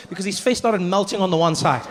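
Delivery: ordinary delivery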